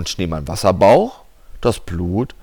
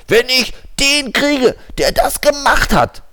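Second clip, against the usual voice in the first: hissing angry; 4 dB louder than usual. A narrator's reading.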